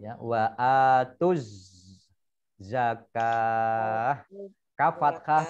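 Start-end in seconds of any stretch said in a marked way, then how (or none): 0.2-4.3 "zakah" stretched, ending on a breathed h; straight into za. Shown as a demonstration. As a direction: hold the z from "atuz" into "zakah". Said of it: "langsung masuk ke huruf za".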